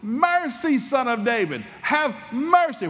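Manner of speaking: high, loud desperate cry; yelling for mercy. Retold or imitated. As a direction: cry out to the passing healer. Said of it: "That's not what he yells".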